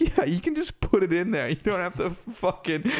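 high, exasperated explaining. Retold or imitated. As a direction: explain it to a clueless friend. Friends explaining to Nick.

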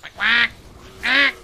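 repeated imitation quacks; silly duck noises in short bursts; duck quacking. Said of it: "Stop being a duck".

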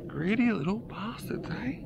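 high, soft, slow coo to the fish; soft teasing coo. Real, not acted. Angler coos at the released fish, high and soft. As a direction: croon a fond insult.

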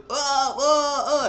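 high, loud hammed-up moans with a laugh; mock moaning. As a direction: moan mockingly while laughing. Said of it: "and he comes on his".

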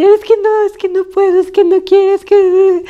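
high-pitched panicky whine, acting out herself; hammed-up frightened refusal in a squeaky high voice; scared. Telling her partner she cannot do it, mock scared. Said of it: "Y yo bien asustada, así".

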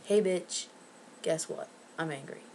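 quiet, deadpan mock confrontation; understated angry announcement. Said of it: "And I'll be like".

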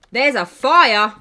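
loud, slow, high exclamation; alarmed. Real, not acted. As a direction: announce it loudly, drawn out in disbelief. Discovers a fire in game.

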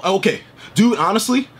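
ordinary talk, nothing marked

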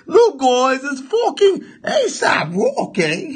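ordinary talk, nothing marked

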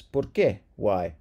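ordinary delivery

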